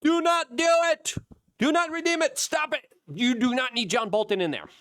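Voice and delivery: high, loud pleading yell; retold scream. Warning Trump off John Bolton, a high loud yell. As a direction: shout it like you're begging him to stop. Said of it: "we're screaming".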